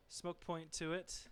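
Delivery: ordinary delivery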